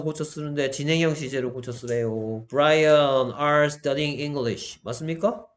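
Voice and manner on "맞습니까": ordinary talk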